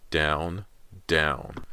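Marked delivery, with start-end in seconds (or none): none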